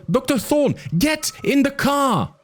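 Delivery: put-on high, urgent kid's voice; a frightened boy pleading over the radio. A narrator's reading. Arby urges Thorn and Malcolm into the car, high and urgent.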